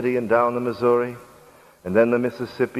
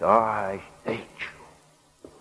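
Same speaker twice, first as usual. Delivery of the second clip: slow, drawn-out hiss through clenched teeth; hissed hatred; 4 dB quieter, much slower than usual. A narrator's reading. Slave woman to her buyer after spitting in his face, slow hateful hiss. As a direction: hiss it very slowly, each word stretched. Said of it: "hissed through her clenched teeth".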